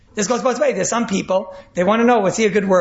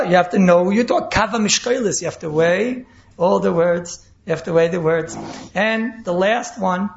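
ordinary talk, nothing marked

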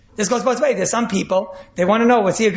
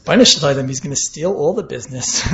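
listener's alarmed thought; 6 semitones lower than usual. Retold or imitated. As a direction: voice the listener hearing the worst. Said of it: "what the other guy just heard is".

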